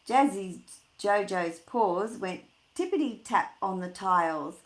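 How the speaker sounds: plain reading voice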